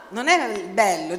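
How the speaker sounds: usual speaking voice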